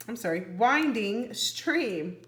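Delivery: plain reading voice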